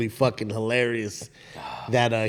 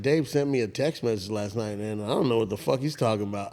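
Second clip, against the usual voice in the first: soft retold quote; 4 semitones lower, 8 dB quieter than usual. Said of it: "He said".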